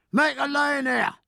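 high, wrathful cry; cried with wrath. Acted. Cook's mate yells back at Killick: wrathful high cry. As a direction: shove your way through with it. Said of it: "cried the cook's mate with equal wrath".

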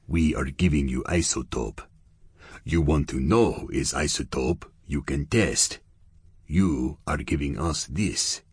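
menacing Russian gangster, clipped English; lightly voiced Russian heavy pressing for answers; a hint of a Russian accent, suspicious. Russian gangster Yevgeny speaks.

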